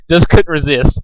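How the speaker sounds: plain reading voice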